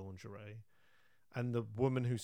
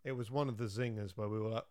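ordinary talk

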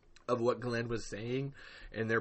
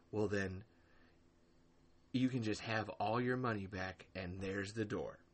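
softer, flat, cold dismissal; quoting Glenn; 3 semitones lower, 7 dB quieter than usual. Retold or imitated. Host quoting Glenn's message to a mod, cold quiet dismissal. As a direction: read his words back dryly. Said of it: "Glenn straight up told this guy".

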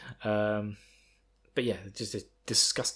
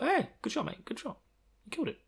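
cheery praise; 13 semitones higher, 5 dB quieter than usual. Retold or imitated. Woman praising Rand.

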